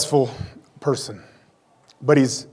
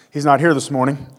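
normal talking voice

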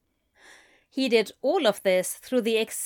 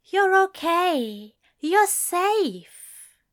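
higher, slow tender angel voice; soothing reassurance; 9 semitones higher, 4 dB louder, much slower than usual. A narrator's reading. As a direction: hush a frightened friend. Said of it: "the angel soothed".

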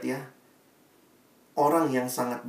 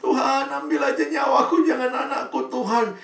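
high, wailing mock cry to God; a grieving mother at her son's coffin, high wailing plea; mother's wailing plea; 13 semitones higher, 6 dB louder than usual. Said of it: "sampai dia minta".

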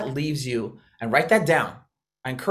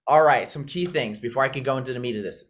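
ordinary talk, nothing marked

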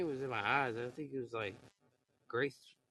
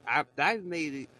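ordinary delivery

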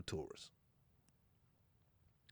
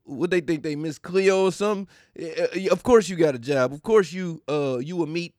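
normal talking voice

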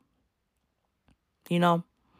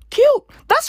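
squealed cute; 5 dB louder than usual. Real, not acted. Reacting to retold tweet.

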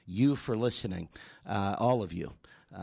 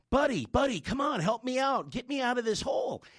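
much higher, urgent calling out; retold frantic plea; 12 semitones higher than usual. Man in the hole calls to his friend, frantic plea. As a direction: shout up to your buddy for help.

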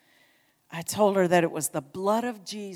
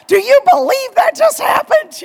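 high, very loud excited shout; excited disbelief; 16 dB louder than usual. Retold or imitated. Two friends amazed after praying over a lady, excited shout. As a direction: squeal it in thrilled amazement. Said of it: "We were kind of excited".

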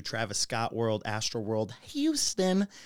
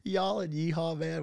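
Texan accent; 7 semitones higher than usual. Shown as a demonstration. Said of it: "when you hear that in Texas authentically".

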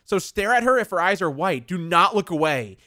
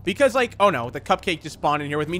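usual speaking voice

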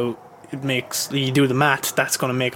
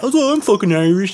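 higher put-on boasting voice; mock boast; 6 semitones higher, 4 dB louder than usual. People he met claiming Irish roots, mock boast. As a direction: brag like a fake Irishman. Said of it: "anyone I met".

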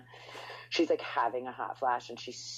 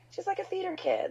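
campy mock. Mocking [name] as theater kid.

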